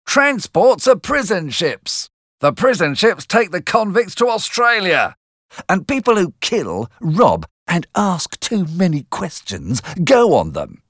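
a hammed-up strict older sister; stern sister. A narrator's reading. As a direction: Mrs Joe lecturing Pip and sending him to bed. Mrs Joe lectures Pip.

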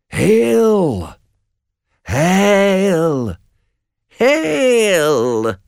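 high, drawn-out eerie wail; witches calling out. A narrator's reading. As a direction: call out long and high like a witch. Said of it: "three women call out to them".

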